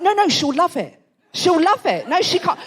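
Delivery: mock dad insisting, a bit high; insistent dad. Insistent dad overriding embarrassed daughter.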